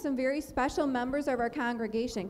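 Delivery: ordinary talk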